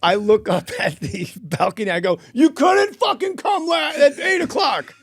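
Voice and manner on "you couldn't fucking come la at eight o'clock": much higher, louder slow shout; furious shout. Retold or imitated. Comic shouting up at the balcony about the late guest. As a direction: bellow up at the balcony.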